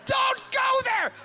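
high, loud shouted warning; panicked high shout; bottom's warning. Retold or imitated. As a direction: yell it as the spanked bottom. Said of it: "Bottom sending message to brain".

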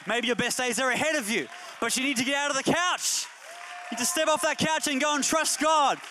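rising sermon climax; preaching at full force. Acted. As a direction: drive it home like a fired-up pastor. Pastor builds to shouting.

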